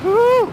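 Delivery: high, loud "woo"; excited whoop. Real, not acted. Rider thrilled by his awesome bike, excited whoop.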